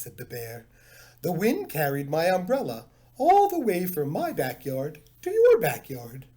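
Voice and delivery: a hint of a gentle panda; polite bear. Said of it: "He spoke with a very slight panda accent".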